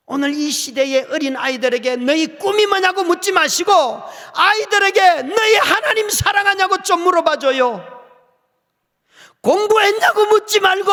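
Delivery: loud passionate appeal; fervent exhortation. Real, not acted. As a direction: plead with the congregation. Impassioned exhortation to congregation.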